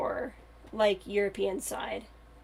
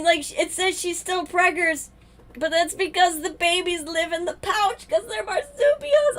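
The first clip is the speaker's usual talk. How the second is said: much higher, louder squeals of joy; squealing with delight; 8 semitones higher, 7 dB louder than usual. Real, not acted. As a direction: squeal like you just saw the cutest thing ever. Excitement returns about marsupial pouch.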